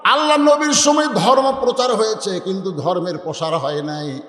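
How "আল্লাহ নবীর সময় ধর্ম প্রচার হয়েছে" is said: normal talking voice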